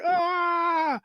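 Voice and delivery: high, long wailing scream; falling scream. Retold or imitated. The guy falling to his death, long high scream. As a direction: scream all the way down. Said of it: "Now he's like".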